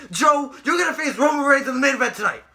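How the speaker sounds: hammed-up announcement, half yelled; Mick Foley. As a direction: imitate an impassioned boss making a proclamation. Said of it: "so he starts screaming".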